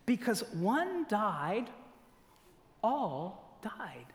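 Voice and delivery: slow, deliberate reading with a pause midway. Shown as a demonstration. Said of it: "read it to you again slowly".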